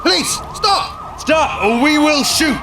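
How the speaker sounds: high, drawn-out yelled command; shouted police warning. Acted. Police to a fleeing suspect, shouted warning. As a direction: call a slow warning across a distance.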